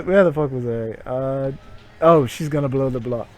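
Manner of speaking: usual speaking voice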